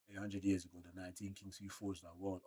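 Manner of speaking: hushed, soft narration of the panel; quiet dramatic reading. Acted. Reading manga lore to the stream, hushed.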